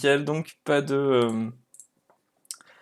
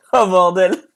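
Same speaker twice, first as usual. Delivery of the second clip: ordinary delivery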